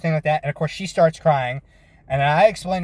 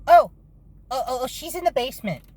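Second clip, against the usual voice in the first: put-on high, quick little-kid blurt; a boy tattling on his mom, lightly voiced, higher and quick; imitating little boy; 8 semitones higher, faster than usual. Boy telling the officer where she hides, high and quick.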